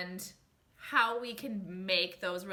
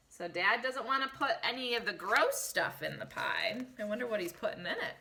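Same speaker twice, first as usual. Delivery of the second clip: plain reading voice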